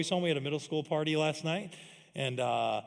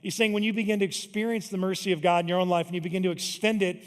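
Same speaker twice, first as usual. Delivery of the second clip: louder, building sermon delivery; preaching; 4 semitones higher, 7 dB louder than usual. Acted. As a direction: drive the point home to the congregation.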